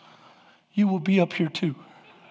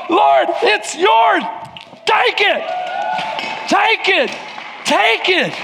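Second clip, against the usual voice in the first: desperate cry to God; 12 semitones higher, slower than usual. Acted. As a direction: cry it out in surrender. Acted desperate surrender prayer.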